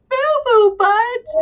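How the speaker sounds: put-on high, slow, drawn-out kid voice; giggly kid. Teacher mimics kids repeating the book's silly name, high and drawn out. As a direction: stretch it out like a delighted child. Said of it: "it's okay that they're like".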